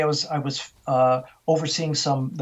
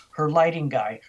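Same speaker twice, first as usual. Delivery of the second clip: normal talking voice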